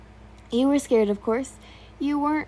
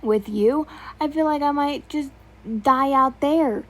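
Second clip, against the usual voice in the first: louder, higher sharp comeback; retort; 5 semitones higher, 4 dB louder than usual. Acted. Scared girl snapping back at the soldier, louder and higher. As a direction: snap back at him, rising to a peak. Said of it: "you retorted".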